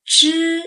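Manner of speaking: Zhi held flat and high, first tone. Shown as a demonstration. Teacher demonstrating Zhi for learners, flat and high. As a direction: sing Zhi level and high. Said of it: "First tone, flat and high".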